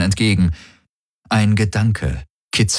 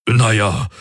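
sheepish hedge; slightly embarrassed; 3 semitones higher than usual. A narrator's reading. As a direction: back off a little awkwardly. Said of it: "sagte Hox etwas verlegen".

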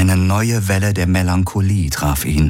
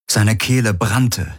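ordinary delivery

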